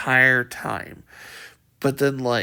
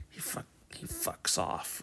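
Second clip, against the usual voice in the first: whispering; 7 semitones lower, 14 dB quieter than usual. A narrator's reading. Whispering while enemy nearby.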